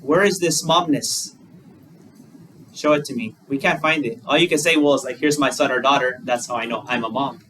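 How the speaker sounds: ordinary talk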